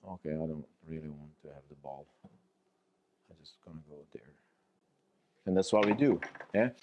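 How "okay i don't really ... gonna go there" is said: very soft, low dog voice; withdrawn and resigned. Acted. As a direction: fade away nearly inaudibly, wanting out. Defeated dog declines the ball.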